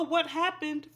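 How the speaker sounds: plain reading voice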